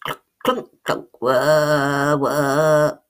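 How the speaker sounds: clunking then whirring; clunking cog noises. A narrator's reading. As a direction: imitate cogs grind and whir. Imitating the cogs whirring.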